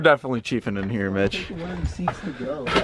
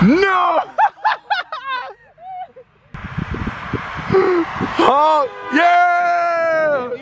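excited cheering; much slower than usual. Real, not acted. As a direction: whoop at the honking car. Cheering a honking car.

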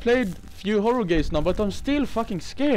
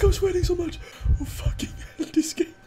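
ordinary talk

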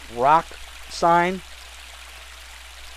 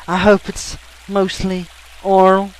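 lightly voiced cheeky spy, slow; a hint of a hammed-up innuendo; Austin Powers; 4 semitones higher, 4 dB louder than usual. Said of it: "Austin Power quote aside".

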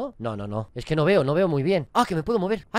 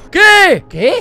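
sudden high, loud shocked cry; real fright, much higher and louder; startled yelp; 11 semitones higher, 15 dB louder than usual. Real, not acted. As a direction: jolt of real surprise. Startled by the game.

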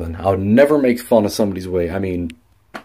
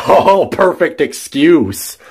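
drawn-out, higher mock admiration; sarcastic; 7 semitones higher, 3 dB louder, slower than usual. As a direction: stretch it out in high sarcasm. Mocks teacher's weak excuse.

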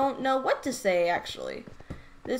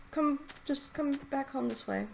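ordinary talk

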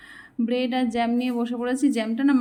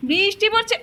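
calling out; 5 semitones higher than usual. Real, not acted. Calling toddler away from rain.

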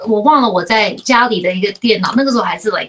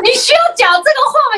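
much higher, fast mock-offended comeback; indignant retort; 12 semitones higher, faster than usual. Daughter protesting her father calling her old, much higher and fast. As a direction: bat it back like a daughter feigning outrage. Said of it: "然后我说".

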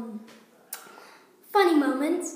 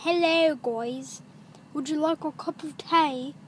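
put-on British accent, drawn vowels; hammy British tea-offer accent. Shown as a demonstration. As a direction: do a mock British greeting. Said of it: "This is how British people talk".

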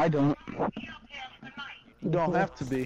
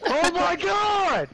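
shocked; 11 semitones higher, 4 dB louder, slower than usual. Real, not acted. Shocked reaction in game.

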